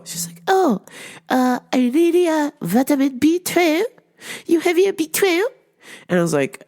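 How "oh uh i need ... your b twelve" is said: sketch-comedy lady, pitched way up; Miss Swan. Retold or imitated. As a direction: imitate a comic customer in a high-pitched voice. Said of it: "So this Miss Swan lady".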